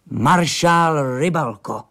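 slow, high-pitched triumphant reveal; proud, emphatic bombshell; fired-off announcement. A narrator's reading. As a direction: fire the name at him, drawing it out. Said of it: "vypálil na něj Fábera".

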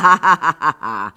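loud acted guffaw; goofy laugh. A narrator's reading. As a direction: burst out in a loud ha-ha-ha. Elvin laughs at his own joke. (hlasitý hloupý smích)

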